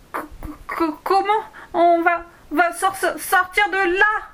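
put-on high, stuttering frightened girl; stammering. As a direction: stumble over every word in fright. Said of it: "balbutia Elona".

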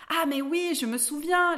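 a touch of happy realisation; mildly delighted recognition. A narrator's reading. Dwarf suddenly recognises his old friend.

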